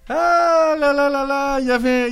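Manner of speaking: slow, high, drawn-out sigh; drawn-out exclamation. Real, not acted. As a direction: stretch it out, way up high. (là là là traînés, très aigus)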